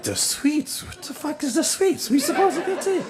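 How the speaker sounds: high, baffled squeak through laughter; can't believe the sign says Suites; baffled disbelief. Retold or imitated. To his friends, a high baffled squeak about The Suites.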